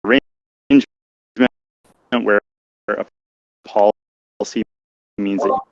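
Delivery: choppy call cutting in and out; breaking up. Real, not acted. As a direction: audio dropping out mid-sentence. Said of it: "You're breaking up, [name]".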